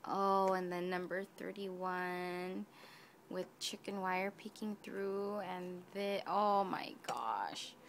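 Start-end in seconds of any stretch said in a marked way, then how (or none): none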